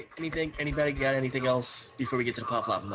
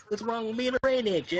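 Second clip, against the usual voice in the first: put-on country accent; 8 semitones higher, 3 dB louder than usual. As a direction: whine it in a country twang. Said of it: "I can do a country accent".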